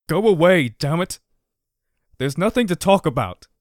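loud, shaking, near tears; trembling shout. A narrator's reading. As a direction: yell it out with a trembling voice. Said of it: "he said in a trembling voice".

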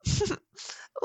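high breathless giggle. Real, not acted. Lover laughing in delight, high giggle. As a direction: let a high giggle burst out.

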